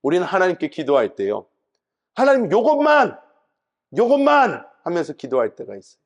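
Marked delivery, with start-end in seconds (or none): 2.2-4.7 mock pleading. Imitating begging prayer.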